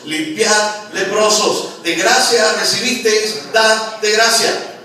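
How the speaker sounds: loud, slow preacher's proclamation; fiery pulpit declaration; preaching at full force. Acted. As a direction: thunder the scripture out slowly.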